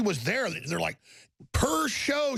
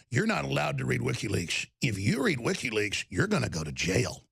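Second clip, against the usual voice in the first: mocking Cuomo; 9 semitones lower than usual. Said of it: "Cuomo saying".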